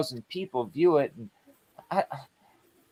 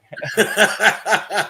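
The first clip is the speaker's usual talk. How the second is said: loud, high-pitched chuckle; a hearty, rolling laugh; 6 semitones higher, 3 dB louder than usual. Real, not acted. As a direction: burst out laughing at your own story. Laughs after telling dog story.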